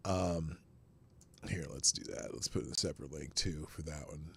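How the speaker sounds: soft, low, half-whispered disbelief; stunned hush. Real, not acted. Streamer stunned after the police clip, soft half-whisper. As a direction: murmur it like you're stunned.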